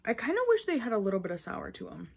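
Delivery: chewy candy in mouth; talking while chewing. Real, not acted. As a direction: mouth partly full of candy. Seen 2: Ate gummy near -5; jaw and lips still working while talking.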